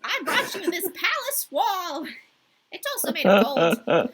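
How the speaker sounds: laughing through a slow mock crow line. Teasing the listener's mishearing in a laughing crow voice. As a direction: joke it in the crow voice, giggling. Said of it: "enunciate in this voice".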